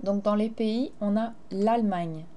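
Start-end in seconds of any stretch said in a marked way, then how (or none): none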